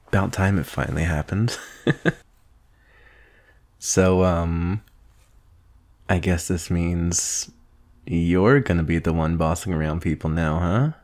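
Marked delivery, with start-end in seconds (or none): none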